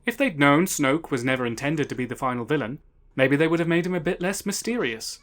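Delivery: usual speaking voice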